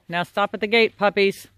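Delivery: ordinary talk, nothing marked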